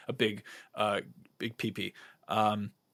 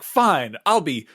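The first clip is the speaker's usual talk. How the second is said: mock theater-kid flourish, raised and louder; theatrical declaration; 5 semitones higher, 7 dB louder, slower than usual. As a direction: announce a stage name with grand, huffy drama. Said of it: "theater kid that is".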